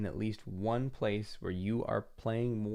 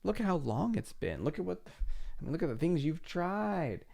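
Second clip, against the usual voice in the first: starts slow and hushed, then rises higher, needling; voice of doubt; 6 semitones higher, slower than usual. Pretend. Inner doubt taunting the listener, slow hush into nagging. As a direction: let doubt whisper, then nag. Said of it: "And it'll say".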